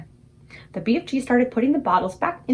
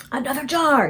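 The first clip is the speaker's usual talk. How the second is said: excited cry; 5 semitones higher than usual. Acted. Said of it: "he cried".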